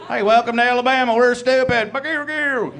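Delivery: high, loud hammed-up yokel voice; mock Alabama sign. Mocking Alabama's border sign in a loud yokel voice. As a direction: shout the welcome like a dim greeter. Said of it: "They don't have a sign on the border".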